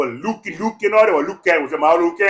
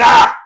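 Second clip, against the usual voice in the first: loud cry breaking into high squealing laughter; whooping laugh; 4 dB louder than usual. Real, not acted. Speaker cracks up calling self ugly, whooping laugh. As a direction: burst out, then squeal with laughter.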